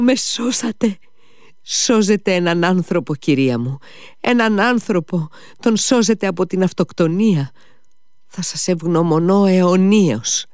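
exalted lady. A narrator's reading. Khokhlakova gushes to Mitya, promising countless thousands. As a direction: swoon with generous rapture. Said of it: "φώναξε η κυρία Χοχλάκοβα".

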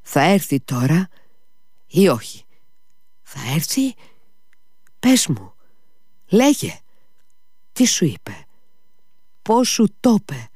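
anxious pleading. Acted. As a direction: press him for news, barely holding on. Mitya's anxious questions.

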